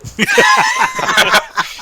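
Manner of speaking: high, loud burst of laughter; a squealing real laugh; shrieking laugh. Real, not acted. Bursts out at the brick joke.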